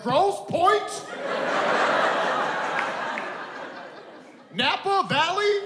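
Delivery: high, slow mock-tough sneer; fake tough guy. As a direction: sneer it like a wannabe thug. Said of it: "Dudes be like".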